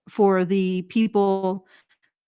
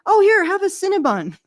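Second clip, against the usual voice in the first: higher-pitched, eager imitation of generous hosts; friendly Texans offering treats; 10 semitones higher, 4 dB louder than usual. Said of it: "they'd be like".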